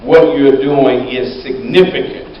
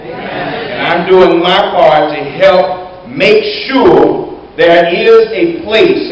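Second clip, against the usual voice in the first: slow, loud and deliberate; earnest preaching; 4 semitones higher, 5 dB louder, slower than usual. Real, not acted. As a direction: drive each word home from the pulpit. Slows to preach the point.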